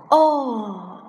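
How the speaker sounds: loud drawn-out falling "ohh"; startled "oh". A narrator's reading. Blind man stumbling onto the elephant's leg, startled cry. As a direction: cry out "oh" on bumping into something.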